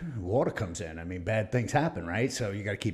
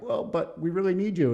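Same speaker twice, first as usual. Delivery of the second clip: much higher mock pleading voice; pleading brass; 9 semitones higher, 5 dB louder than usual. Navy begging the officer to stay, mock pleading. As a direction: beg him like a needy boss. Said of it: "they're like".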